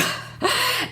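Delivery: a bright high burst of laughter after the news; delighted laugh. Real, not acted. Laughing while telling listeners she earned the car. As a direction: laugh with real delight at your own win.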